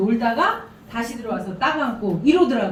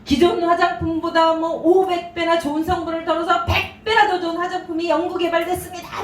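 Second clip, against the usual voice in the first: higher-pitched mock news report; news announcer; 6 semitones higher than usual. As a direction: imitate an excited cosmetics news bulletin. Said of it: "화장품 뉴스를 톡 틀어주더니만". (뉴스 앵커 흉내)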